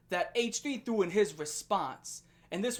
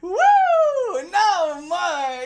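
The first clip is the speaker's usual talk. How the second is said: high, loud hammed-up cry of surrender; mock wail, much higher and louder; mock pleading; 8 semitones higher, 11 dB louder, much slower than usual. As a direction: beg him to stop like a wrestler tapping out. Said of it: "He's like".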